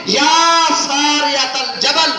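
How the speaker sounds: slow, high call across the distance. Acted. Caliph on the pulpit calls to his distant commander, slow high call. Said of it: "تو انہوں نے اس کو آواز دی".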